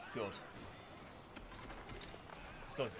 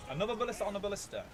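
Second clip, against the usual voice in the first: exasperated; 9 semitones higher, 4 dB louder, faster than usual. Real, not acted. Exasperated at enemy ballista.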